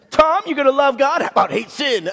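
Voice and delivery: loud, high-pitched mocking taunt; taunting sister. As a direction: tease a brother loudly, imitating his sisters. Sisters taunt him about shirt.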